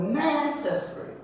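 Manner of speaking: necessary with NE long and high; demonstrated NE-cessary; stress on first syllable. Shown as a demonstration. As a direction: pitch NE high, let necessary fall away. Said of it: "It's the first syllable".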